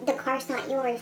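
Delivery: a hint of a curt office lady; imitating clerk.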